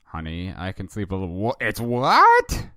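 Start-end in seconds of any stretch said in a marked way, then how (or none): 1.3-2.7 drawn-out startled shout; jolted awake by the late hour. Acted. Husband shocked at the time, loud and slow.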